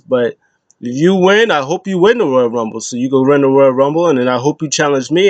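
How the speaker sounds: ordinary talk, nothing marked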